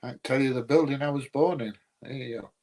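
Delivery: normal talking voice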